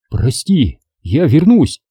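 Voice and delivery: ordinary delivery, nothing marked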